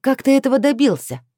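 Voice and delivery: loud, high, indignant demand; rumbling anger. A narrator's reading. Heroine confronting Chris by phone, thundering angrily. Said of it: "пророкотала я".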